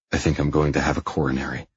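usual speaking voice

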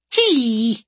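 high chirping animal voice; creature chirp. Acted.

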